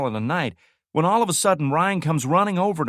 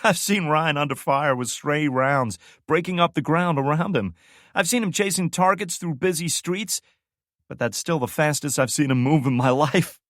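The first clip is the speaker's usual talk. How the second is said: barely holding laughter; 8 semitones higher, 6 dB louder than usual. Acted. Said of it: "struggling to keep it together".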